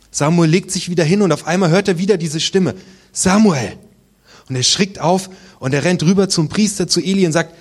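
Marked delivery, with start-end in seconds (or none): 3.1-3.8 put-on a higher call of the boy's name. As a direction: call out Samuel, a little higher. God's voice calls again. (rufende Stimme)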